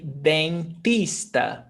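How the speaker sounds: dentista with a hard T, den-TEES-ta; slow dentista with a plain T in TIS. Shown as a demonstration. Teacher offers student another accent, hard T. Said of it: "with the tista, T T T".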